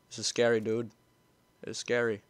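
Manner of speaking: normal talking voice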